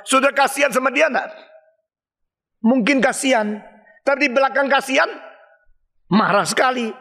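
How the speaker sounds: normal talking voice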